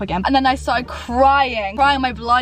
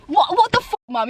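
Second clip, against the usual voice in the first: high, rising disbelief; shocked what the; shocked outburst; 8 semitones higher than usual. Real, not acted. Shocked at mum's betrayal.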